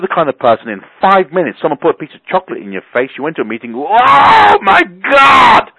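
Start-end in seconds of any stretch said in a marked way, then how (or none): 3.7-5.7 shrieked, drawn-out mock delight, much higher and louder; slow ecstatic over-the-top squeal; excited gasp. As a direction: gush like someone just handed chocolate. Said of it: "That's not the reaction".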